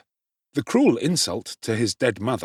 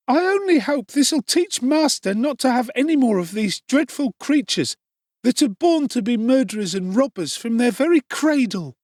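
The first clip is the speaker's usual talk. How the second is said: indignant Charlotte; 13 semitones higher, 4 dB louder than usual. Acted.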